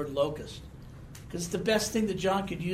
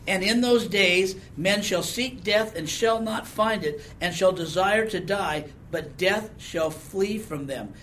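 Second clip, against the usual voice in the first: slow, louder solemn reading of the verse; scripture reading; 3 semitones higher, 4 dB louder than usual. Acted. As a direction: read it like holy writ, weighty. Reads scripture verse aloud.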